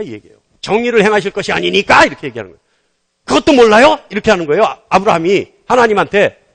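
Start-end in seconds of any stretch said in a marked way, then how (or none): 0.6-2.0 mock grumbling protest, rising at the end; complaining challenge. Preacher acting Abraham challenging God, complaining protest. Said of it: "이게 투정이에요". 3.2-4.0 high, loud mock indignation; complaining challenge. Preacher acting Abraham talking back to God, loud complaint. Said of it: "이게 투정이에요".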